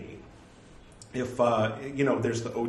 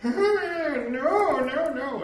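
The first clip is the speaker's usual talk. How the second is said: very high, loud squeal of objection; hammed-up horror at a mangled word; mock French disapproval; 8 dB louder, much slower than usual. French listeners reacting to a learner's mistake, mock disapproval. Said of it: "if you get it a little wrong, they go".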